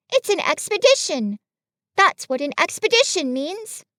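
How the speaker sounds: put-on high, assured storybook boy voice; Christopher Robin, confident. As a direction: explain it confidently, pitched well up.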